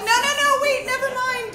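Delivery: high, loud, flustered mock panic; panicked protest. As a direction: call them off in comic alarm. Panicked aunt stopping nieces.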